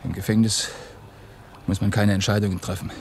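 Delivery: usual speaking voice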